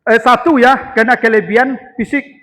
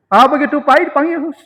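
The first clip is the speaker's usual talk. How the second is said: raised calling voice; 7 semitones higher, 6 dB louder than usual. Acted. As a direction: call him over from afar. Umar calling out to friend. (memanggil dengan suara keras)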